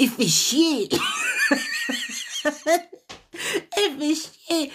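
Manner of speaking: helpless giggle at her own swearing; laughing. Real, not acted. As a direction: crack up and repeat it through the laugh.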